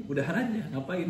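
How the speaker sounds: high-pitched dismissive retelling; retold blunt advice. Father advises daughter to break up, high dismissive retold tone. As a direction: voice offhand advice, pitched well up.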